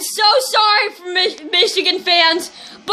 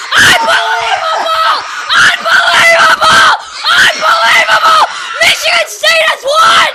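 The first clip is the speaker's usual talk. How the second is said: loud, higher ecstatic play-by-play yell with laugh-cry breaks; real tearful joy, shouting the call; 4 semitones higher, 3 dB louder than usual. Real, not acted. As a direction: scream it like your team just stole the game. Celebrating a last-second winning touchdown.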